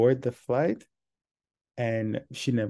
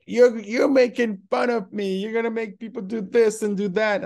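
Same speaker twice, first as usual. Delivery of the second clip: high, loud indignant imitation; 11 semitones higher, 5 dB louder than usual. Drunk passenger accusing the staff, high indignant yelling. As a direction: act out the offended passenger yelling. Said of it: "very loud".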